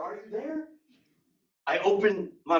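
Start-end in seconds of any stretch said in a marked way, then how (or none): 0.0-0.6 mild panic. Acted. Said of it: "I started to panic a little bit".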